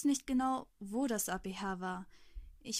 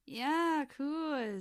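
feigned enthusiasm; 5 semitones higher, 5 dB louder than usual. A narrator's reading. Narrator feigning enthusiasm aloud.

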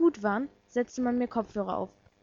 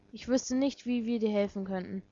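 ordinary delivery, nothing marked

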